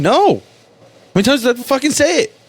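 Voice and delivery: very high, indignant protest; testy outburst. Acted. Driver asked by the cop if he's been drinking, testy outburst. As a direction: burst out exasperated, pitch leaping way up. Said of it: "I get testy with cops".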